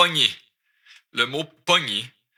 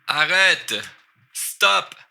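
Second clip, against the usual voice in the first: high, firm order to halt; raised-pitch demand to stop; acted command; 7 semitones higher, much slower than usual. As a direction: call it out as a sudden command. Acts out telling someone to stop.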